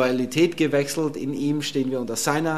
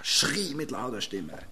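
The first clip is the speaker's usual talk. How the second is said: mock demon cry; 3 semitones lower, 8 dB quieter, slower than usual. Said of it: "fängt hier an".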